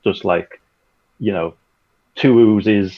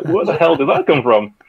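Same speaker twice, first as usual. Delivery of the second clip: retold bafflement, pitched up; baffled exclamation; 7 semitones higher, 3 dB louder than usual. Producer retelling his reaction to an unexpected accent, baffled and higher. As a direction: exclaim it as if caught off guard.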